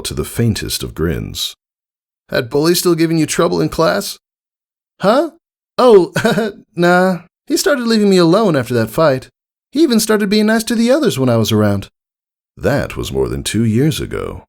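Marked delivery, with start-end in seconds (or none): 2.3-4.2 high tomboy pony, hesitant; fishing for conversation; awkward Rainbow Dash. A narrator's reading. 5.0-11.9 high filly voice, a laugh, then fast; brushing off an old problem; puzzled Scootaloo. A narrator's reading.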